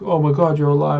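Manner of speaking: high, loud relieved cry; acted joyful disbelief, pitched right up; astonished relief. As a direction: exclaim in amazement at a friend's survival. Friends greet revived comrade.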